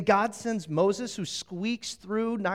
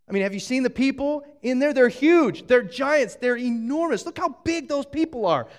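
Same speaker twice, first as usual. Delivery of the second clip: louder, higher, faster panicked rush; alarmed exclaiming; 6 semitones higher, 7 dB louder than usual. Retold or imitated. Israelites panicking about the giants, loud alarmed rush. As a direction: blurt it out in wide-eyed alarm.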